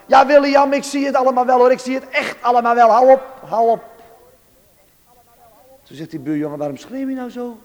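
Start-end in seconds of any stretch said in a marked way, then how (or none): 0.1-3.8 high, loud exasperated outcry; loud shouting. Retold or imitated. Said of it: "die riep toen heel hard". 6.6-7.6 higher-pitched, mild bewilderment; imitating a boy taken aback by the outburst; puzzled friend. Startled friend asks crying boy.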